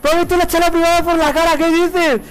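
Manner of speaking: high, loud disbelieving yells; shocked streamer yelling into the mic; shocked shouting. Real, not acted. Shocked after private chat mix-up.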